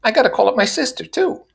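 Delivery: high, proud announcement; announcing. A narrator's reading. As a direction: declare it grandly. Mrs. Wilson voiced.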